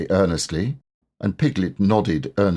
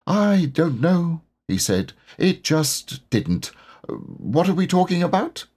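Pooh voice; 9 semitones higher than usual. A narrator's reading. Pooh voiced slow and high.

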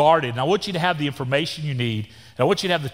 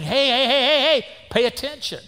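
God calling out; 8 semitones higher than usual. Retold or imitated. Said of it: "God is saying".

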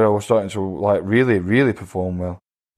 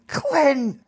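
put-on high, slow, drawn-out drunk cheer; drunk wife yelling; much slower than usual. Opponent's drunk wife urging him on from ringside, high and drawn out. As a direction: shriek it like a drunk fan at ringside. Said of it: "She's going".

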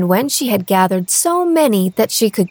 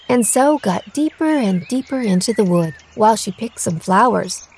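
usual speaking voice

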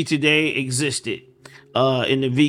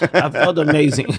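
louder, punchier retake with a laugh; emphatic; 3 semitones higher, 6 dB louder than usual. Real, not acted. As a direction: stress it again with energy. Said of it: "this feels crazy when I'm talking like this".

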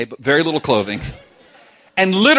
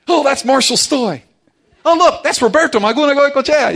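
much higher, louder, quick excited calls; excited spotting; 11 semitones higher, 6 dB louder than usual. Retold or imitated. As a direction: point out a lookalike with glee. Making calls while people watching.

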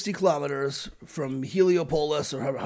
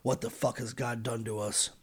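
muttered dread; 3 semitones lower, 8 dB quieter than usual. Retold or imitated. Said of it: "said, again, collectively".

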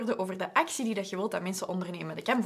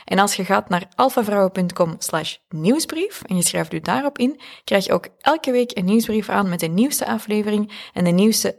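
upbeat announcer promo read; louder, bright commercial voice; 9 dB louder than usual. Acted. Reads a promotional ad break.

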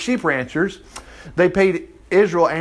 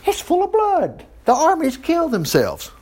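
excited alarm; 12 semitones higher than usual. Retold or imitated. Moab scouts seeing red water, shrieking in high alarm. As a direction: play the scouts crying out in shock. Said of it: "and they go".

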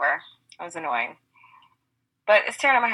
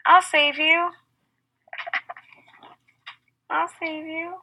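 heroic rescuer; 11 semitones higher than usual. Retold or imitated. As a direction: call out a rescue. Acting out pool rescue.

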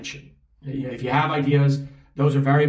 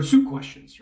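quick attempt at a Sean Connery Scottish accent, pitched much higher; jokey Scottish accent, Sean Connery style, much higher; 11 semitones higher, slower than usual. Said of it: "my attempt to do that accent".

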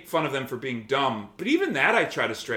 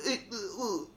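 much softer, sputtering exasperated ugh; flustered groan; 7 semitones higher, 11 dB quieter than usual. Retold or imitated. Imitating a beaten troll, sputtering a soft exasperated ugh. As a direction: run out of words, groaning. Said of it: "And they're like".

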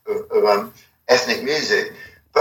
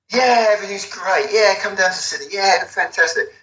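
higher, airy, everything's-fine voice; breezy easygoing Michael; 6 semitones higher than usual. Retold or imitated. Mimicking Michael's easy promises, light and breezy. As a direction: breeze through it like nothing's a problem. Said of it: "Michael was one of these people that".

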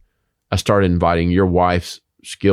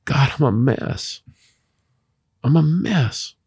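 humble confession; 7 semitones higher, slower than usual. Retold or imitated. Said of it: "or this".

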